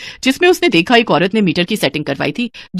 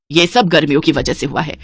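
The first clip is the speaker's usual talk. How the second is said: ordinary delivery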